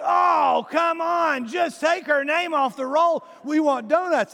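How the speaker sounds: loud, high mock groan from the whole class; class groaning protest. Students protest to teacher, loud high mock shout. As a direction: pitch it up and shout it like a room of impatient teens. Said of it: "everybody goes".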